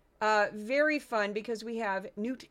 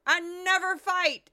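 loud, higher, furious ranting; outraged yelling impression; 4 semitones higher, 6 dB louder than usual. Mocking Stephen Miller ranting on Fox, shouting. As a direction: rant like he's outraged all the time. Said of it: "He's like".

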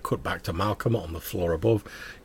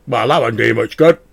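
louder, ringing sword voice; talking sword voice; 2 semitones higher, 10 dB louder than usual. Acted. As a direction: speak as the sentient sword. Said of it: "the voice of the sword".

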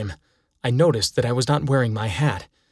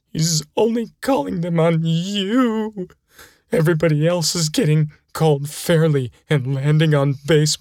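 a touch of a whiny, hurt coach; teary coach; 4 semitones higher than usual. Acted. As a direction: coach complaining about the umpire, near tears. Coach Death pleading, welled-up eyes.

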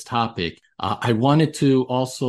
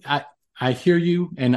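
ordinary delivery

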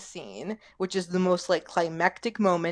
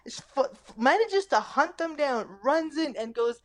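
ordinary delivery

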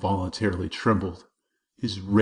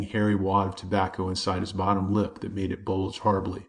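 plain reading voice

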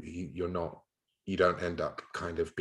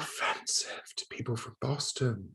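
higher, whispery pearl-clutching gasp of outrage; mock offended; 8 semitones higher than usual. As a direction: imitate a prissy, scandalized young person.

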